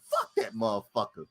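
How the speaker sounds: deep quiet mock grumble; mimicking Fred. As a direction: grumble it under the breath. Fred voiced.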